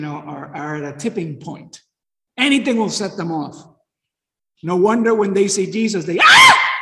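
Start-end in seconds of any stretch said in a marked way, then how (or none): 6.2-6.7 a piercing high shout of fright; terrified scream. Retold or imitated. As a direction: scream as if you just saw a ghost. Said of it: "They, they scream".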